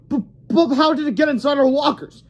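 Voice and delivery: put-on puzzled kid; confused bully.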